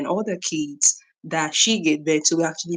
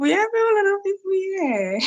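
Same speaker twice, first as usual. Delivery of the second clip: much higher, gleeful sing-song; delighted high exclaim. Real, not acted. Host delighted at how many viewers joined, high gleeful call. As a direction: marvel at the crowd of viewers.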